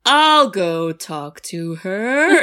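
very slow, dazed drone; drugged-out dad. Retold or imitated. Mocking the sedated movie dad, very slow and dazed. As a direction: drag it out like he's half asleep. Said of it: "he'd be like".

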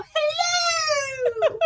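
very high shrill granny hello; Mrs. Doubtfire. Acted. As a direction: sing it out like a Scottish nanny. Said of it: "doing my Missus Doubtfire".

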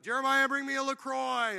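put-on loud, high call to the kid; wife calling her son from the porch. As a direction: shout the order across the house. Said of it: "she'll be like".

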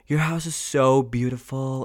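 breathless, slow gasp of awe; gushing friend. Retold or imitated. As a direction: gush like she can't believe the house. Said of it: "she was like".

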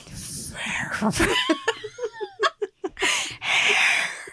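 high breathy laugh. Real, not acted. Co-host laughs.

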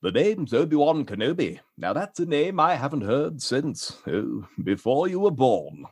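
put-on old British Jedi reminiscing; wistful British mentor, slowing at the end; Obi-Wan Kenobi. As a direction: recall a long-lost name, British accent.

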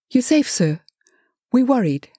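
high-voiced anxious underling; nervous young man, high pitch; agitated, nervous. A narrator's reading. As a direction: sound worried and on edge. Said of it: "he was agitated, nervous, and in fear".

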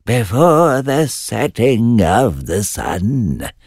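lightly voiced slow witch; a hint of a croaky hag, slow; third witch. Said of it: "added the third".

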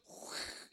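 short voiceless whoosh; firework fizz. Retold or imitated. Recalling a two-second firework, imitated fizzing whoosh. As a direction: imitate a little firework sputtering. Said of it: "They'd go".

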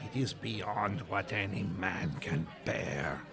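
dramatic French refusal; French waiter. Acted. Pettiford refuses dessert.

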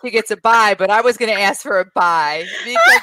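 loud, laughing, high playful protest; half-laughing mock complaint; excited laughing protest. Real, not acted. [name] protests, laughing loudly.

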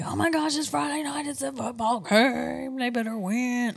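hammed-up game-night hype; mock sports fan. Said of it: "I'm not like".

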